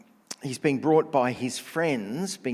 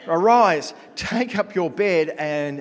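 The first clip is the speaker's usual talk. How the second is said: arise called out high and loud, slowing; ringing call to get up; loud command; 7 semitones higher, 11 dB louder, slower than usual. Retold or imitated. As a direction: command him to stand up, loud and slow. Jesus commands the man to rise.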